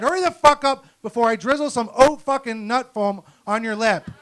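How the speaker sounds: high, loud furious rant; a mock furious outburst, shouted high; angry yell. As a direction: blow up at the barista. Snapping at the slow barista.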